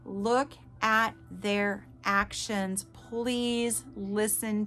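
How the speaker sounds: very slow, word by word; emphatic plea. Real, not acted. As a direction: spell the warning out slowly for them. Urgent advice to listeners, spelt out.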